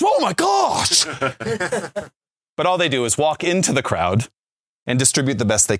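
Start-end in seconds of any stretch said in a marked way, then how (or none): 0.0-1.1 high-pitched, drawn-out cry of amazement; a teenager suddenly seeing the umbrellas, slow and very high; amazed teen gasp. Retold or imitated. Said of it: "and he looked at me and he goes". 1.1-2.0 short laugh. Real, not acted. Laugh after the teen's outburst.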